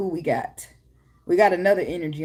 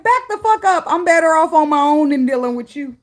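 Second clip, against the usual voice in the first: high, loud indignant declaration; defiant rebuff; 7 semitones higher, 5 dB louder than usual. Acted. Somebody rejecting the lover who undervalued them, loud defiance. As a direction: push it out loud, warding them off.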